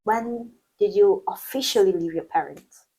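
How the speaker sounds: ordinary talk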